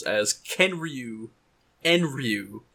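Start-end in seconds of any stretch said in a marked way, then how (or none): none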